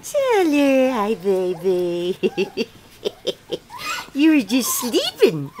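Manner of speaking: ordinary talk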